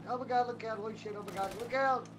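usual speaking voice

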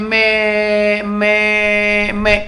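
long drawn-out monotone call for mother; mock child's wail. Hungry child calls mother from inside house, imitated drawn-out wail. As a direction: imitate a child calling out, each word stretched. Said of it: "Budak duk teriak dalam rumah".

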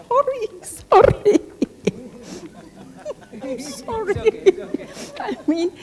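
high, giggling apology through laughter; laughing. Real, not acted. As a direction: laugh it off, embarrassed at the joke. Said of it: "I'm just joking". (tertawa geli sambil minta maaf)

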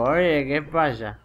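slow, slurred drunk imitation. Imitating the drunk man he hears, slow and slurred. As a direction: drag it out like a drunk guy. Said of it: "se le escucha ahí como".

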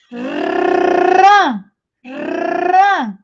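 "rã" with a long trilled R and nasal vowel; nasalised syllable. Shown as a demonstration. Teacher showing the class the N, trilled nasal "rã". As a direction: roll the R, then send the Ã through the nose in "rã". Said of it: "vai sair aqui pelo meu nariz".

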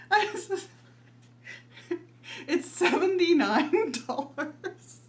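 laughing through the price, high and slow; can't keep a straight face; laughing in disbelief. Real, not acted. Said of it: "can't say that without laughing".

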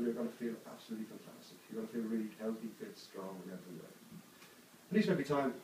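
soft, low soothing hypnotist voice; hushed reassurance, trailing down low; hypnotic suggestion. Acted. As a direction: lull the subject with quiet, sinking phrases.

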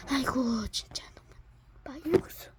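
whispered weary aside. Real, not acted. Host complaining quietly to himself. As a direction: say it under your breath, worn out.

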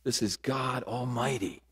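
put-on soft, low and intimate, as God calling a child; hushed divine voice, gently addressing each listener; God's whispering voice. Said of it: "voice whispering".

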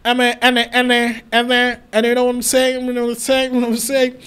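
mocking, repetitive fake British accent; mock acquired British accent. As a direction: imitate a returnee faking a British accent, all fillers. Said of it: "they start speaking like".

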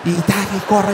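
ordinary talk

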